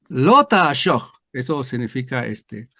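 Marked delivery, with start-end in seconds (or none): 0.1-1.2 very slow, louder Hebrew lo ta'ashoch; Hebrew reading. Shown as a demonstration. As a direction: read lo ta'ashoch syllable by syllable. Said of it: "empieza diciendo". (lectura lenta en hebreo de lo ta'ashoch)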